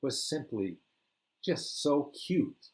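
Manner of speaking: usual speaking voice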